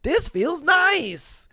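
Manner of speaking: a guy loving the attention, hammed up; mock Jimmy savoring it. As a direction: stretch each word out like you're basking. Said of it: "he was just like".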